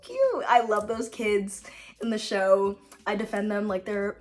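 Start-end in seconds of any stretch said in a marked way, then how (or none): 0.0-0.4 high, slow gush; gushing over how cute it is. Real, not acted. Vlogger gushing about the Percy Jackson kids, squealing high.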